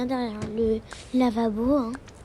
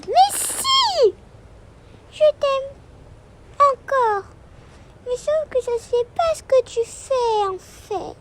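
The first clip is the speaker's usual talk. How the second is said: put-on high, loud "Mais si" from a child; little girl pouting, then softening; young daughter arguing with her mother, high pitched; insistent little girl; 13 semitones higher, 7 dB louder than usual.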